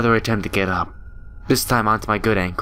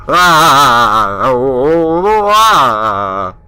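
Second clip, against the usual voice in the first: long loud terrified screams; 4 semitones higher, 10 dB louder, much slower than usual. Acted. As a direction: scream in full, as if falling. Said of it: "now I have to scream".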